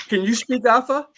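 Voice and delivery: ordinary talk, nothing marked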